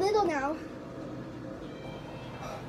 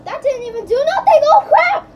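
frustrated yell; 8 semitones higher, 9 dB louder than usual. Real, not acted. Frustrated gamer yelling at game.

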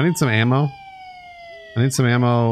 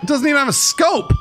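outraged shout; 14 semitones higher, faster than usual. Real, not acted. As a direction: yell in disbelief at the useless rifle. Disappointed by the new rifle.

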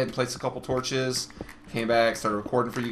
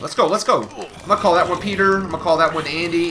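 excited; 5 semitones higher, 7 dB louder than usual. Real, not acted. Naming mobs while fighting them.

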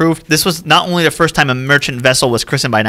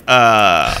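long, lower-pitched stalling "uh"; awkward hesitation; 5 semitones lower than usual. Acted. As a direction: draw it out, stuck for an answer. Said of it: "Cunard guys are like".

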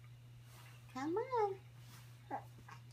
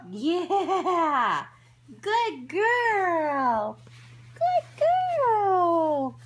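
loud, joyful praise with a laugh; excited cheering; 9 dB louder, much slower than usual. Real, not acted. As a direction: cheer her on, beaming. Baby succeeds, cheering.

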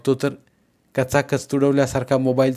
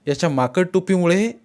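ordinary delivery, nothing marked

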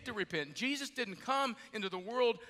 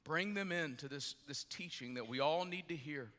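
lower, softer spoken prayer with pauses; 4 semitones lower, 4 dB quieter than usual. Real, not acted. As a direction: pray quietly over the congregation. Leads congregation in prayer.

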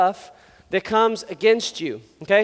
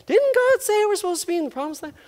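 put-on high, sustained child's voice; kid's question; 10 semitones higher than usual. Kid wandering the desert questioning the grown-ups, high voice. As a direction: pipe up as a puzzled kid. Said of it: "some kid's like".

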